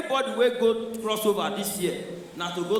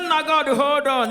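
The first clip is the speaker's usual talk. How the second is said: excited; 6 semitones higher, 5 dB louder, slower than usual. Retold or imitated. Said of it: "He said".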